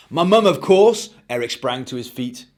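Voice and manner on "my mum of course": put-on bright, high, bouncy kid voice; excited child. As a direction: gush like a thrilled little boy. Eric answers himself.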